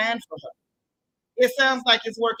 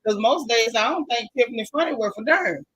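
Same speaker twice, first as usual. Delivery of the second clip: usual speaking voice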